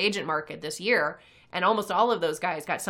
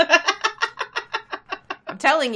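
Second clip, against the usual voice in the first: laughing; 10 semitones higher, 7 dB louder, much slower than usual. Real, not acted. Laughing through words.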